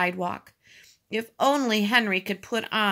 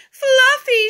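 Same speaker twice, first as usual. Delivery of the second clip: put-on very high, loud baby-talk coo; exaggerated high-pitched cooing; 11 dB louder than usual. A narrator's reading. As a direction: gush over a pet cat. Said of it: "she cooed".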